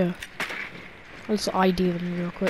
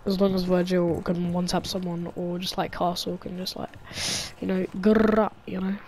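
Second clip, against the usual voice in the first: ordinary talk, nothing marked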